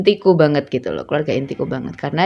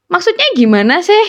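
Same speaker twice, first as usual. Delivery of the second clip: mildly baffled retold question, pitched up; confused questioning; 11 semitones higher, 3 dB louder than usual. Asking a friend what they meant, confused. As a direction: ask it high, as if lost. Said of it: "Terus aku bingung kan".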